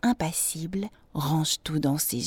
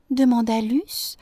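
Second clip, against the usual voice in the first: plain reading voice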